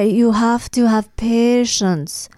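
very slow mock Russian accent. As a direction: drag out each word in mock Russian. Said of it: "She's like".

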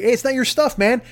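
ordinary talk, nothing marked

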